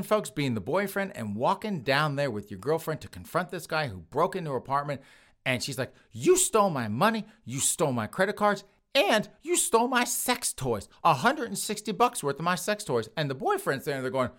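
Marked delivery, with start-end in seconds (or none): 6.1-13.1 higher, indignant accusing woman; lightly hammed-up indignation at the thief; mock accusation. Victim confronts the burglar.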